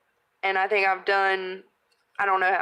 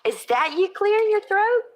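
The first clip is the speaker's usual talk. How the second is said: higher, louder nosy question; mock commenter; 12 semitones higher, 4 dB louder than usual. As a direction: play the pointed commenter. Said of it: "someone had to come on there and say".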